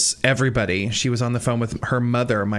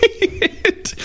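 laugh. Real, not acted. Laughing mid-sentence at bagpipe.

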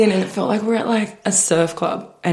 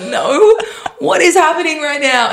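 bewildered; 9 semitones higher than usual. Retold or imitated. Retelling her baffled reply to the hugging man, high. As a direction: act baffled and alarmed.

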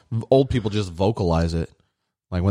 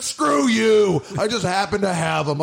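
put-on grumpy old man; 10 semitones higher, slower than usual. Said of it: "He was like".

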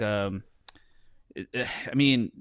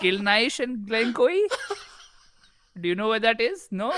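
put-on higher questioning in an Indian accent; 7 semitones higher than usual. Lost visitor asking whether anyone knows the place, Indian accent. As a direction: ask hopefully with an Indian accent. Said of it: "Do it in your Indian accent".

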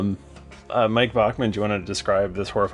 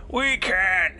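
high, very slow voice, full of distrust; suspicious old dwarf; 11 semitones higher, much slower than usual. Acted. Long-bearded elder doubting the goblins at council, high and slow. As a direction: drag it out like a wary old dwarf. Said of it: "is like".

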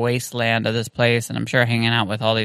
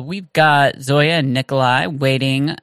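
usual speaking voice

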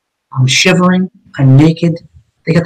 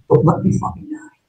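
whispered plea, breath only; desperate whisper; 8 dB quieter than usual. Retold or imitated. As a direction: beg him in a bare whisper, no voice. Begging sergeant not to die.

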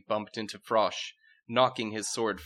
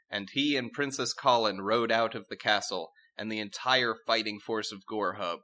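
normal talking voice

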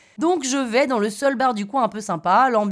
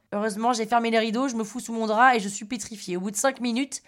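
ordinary talk

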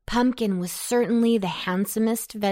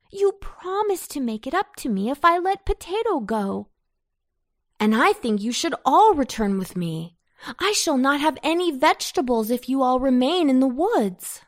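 put-on higher-pitched, pleading boyish protest; dismayed; 5 semitones higher, 3 dB louder, faster than usual. A narrator's reading. Boy fearing he'll lose all his vegetables, dismayed. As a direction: protest with lifted voice, mildly dismayed. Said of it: "cried Anton in dismay".

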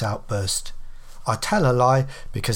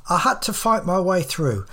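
ordinary delivery, nothing marked

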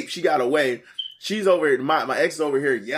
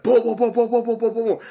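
rapid run of high booms; imitated pounding; 9 semitones higher, 4 dB louder than usual. Ex-wife pounding on the back window, imitated banging. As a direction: hammer out the booms like fists on glass. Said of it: "pounding on the fucking back window".

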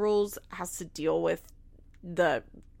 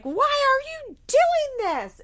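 much higher, slow, louder mock yell; imitating a mother yelling at her kid; drawn-out high exasperated yell; mock yelling Barbara; 5 dB louder, slower than usual. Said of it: "she screams in his face".

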